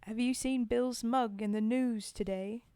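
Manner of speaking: put-on Cockney reading voice; rough attempt at a Cockney accent. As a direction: read it as an amateur Cockney. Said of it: "crappy accent".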